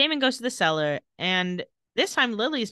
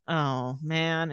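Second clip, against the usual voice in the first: low, soft mock resignation; resigned dismay; 5 semitones lower, 5 dB quieter than usual. Damon hearing the gun cock, low resigned dismay. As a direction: let it sink down quietly, caught out.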